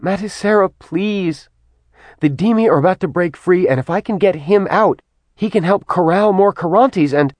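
high, urgent begging; pleading. Acted. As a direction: plead fast before she's cut off. Kat pleading urgently with mother.

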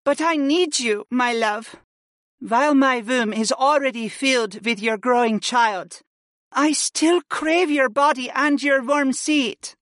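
high-pitched woman's voice, yearning; longing wife. Acted. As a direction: plead with husband in a high voice. Voices Freya longing for husband.

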